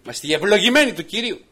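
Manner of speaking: ευλογημένοι ringing out loud and high; triumphant proclamation. Shown as a demonstration. Preacher answering his own question, loud and triumphant. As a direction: declare ευλογημένοι like a victory shout. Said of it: "Όχι".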